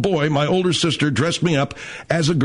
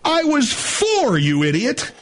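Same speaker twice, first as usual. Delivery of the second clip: slow, high indignant protest; 9 semitones higher, slower than usual. Real, not acted. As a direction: stretch it out, offended.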